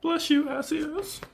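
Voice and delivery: high falsetto. Acted. Playing character in falsetto.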